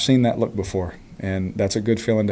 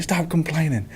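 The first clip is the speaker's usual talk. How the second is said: high, mock coach's yell without the volume; half-hearted high-pitched retold yell; imitating yelling coach; 7 semitones higher than usual. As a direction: mimic a nagging coach, pitched up. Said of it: "he was yelling at me".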